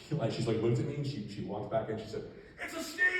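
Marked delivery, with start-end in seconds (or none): 2.6-3.1 very high, loud comic shriek; mock alarmed cry. Manager suspecting the officer, in a shrieked mock alarm. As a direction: squeal it like a hammed-up panic.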